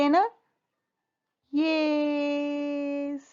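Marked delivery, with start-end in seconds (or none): 1.5-3.3 long, held, higher "yes"; drawn-out yes. Pretend. Answering the teacher's question like a class, drawn-out yes. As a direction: stretch it out on one raised note.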